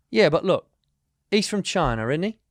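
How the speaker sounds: put-on British driver arguing his point in a light accent. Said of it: "the accent you just did".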